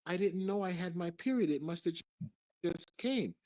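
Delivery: imitating her in a high-pitched voice; feigned innocence. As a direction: pretend to be surprised, pitched well up. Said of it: "she pretends like".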